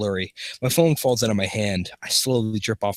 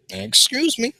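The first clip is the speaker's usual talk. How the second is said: stranger voice; 13 semitones higher, slower than usual. Acted.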